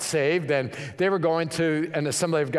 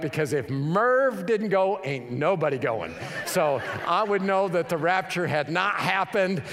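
ordinary delivery

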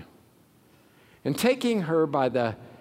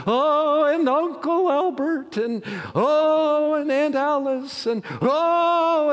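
high, loud hammed-up wailing; mock wailing mourner; 13 semitones higher, 4 dB louder, slower than usual. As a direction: wail out each name like a hired mourner. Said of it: "wail out the names".

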